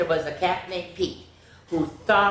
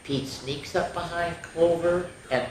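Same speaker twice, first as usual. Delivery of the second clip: ordinary delivery, nothing marked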